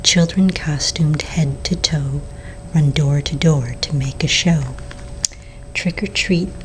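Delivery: plain reading voice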